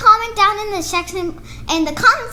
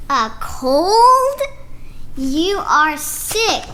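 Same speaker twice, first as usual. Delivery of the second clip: lively picture-book read-aloud with character voices; reading to children; slower than usual. Acted. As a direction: read it like story time for little kids.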